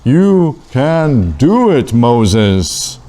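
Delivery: slow, drawn-out divine reassurance; voice from the bush. Acted. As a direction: stretch each word out solemnly, like God calling. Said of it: "And the voice said".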